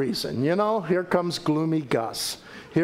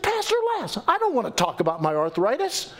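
light Jackie voice; 9 semitones higher than usual. Retold or imitated. Voicing elderly Jackie.

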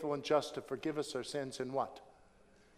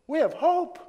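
louder proclamation; 13 semitones higher, 10 dB louder than usual. Acted. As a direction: echo it higher and louder. Repeated proclamation, louder second take.

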